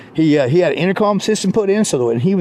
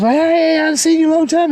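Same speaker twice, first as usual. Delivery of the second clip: much higher, louder delighted hello; excited greeting; 14 semitones higher, 4 dB louder than usual. Retold or imitated. Friend greeting his buddy on the corner, high and excited. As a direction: greet an old friend you haven't seen in ages.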